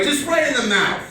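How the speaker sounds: normal talking voice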